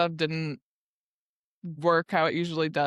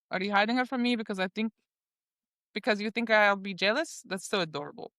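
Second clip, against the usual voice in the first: put-on teasing character voice in a Russian accent; 3 semitones higher, faster than usual. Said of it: "I should be doing this in my Russian accent".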